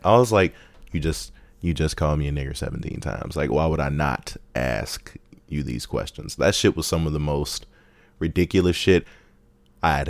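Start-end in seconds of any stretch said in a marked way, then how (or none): 0.9-6.4 much softer, slowed deadpan. Retold or imitated. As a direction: flatly point out the obvious. Deadpan reply to the patient.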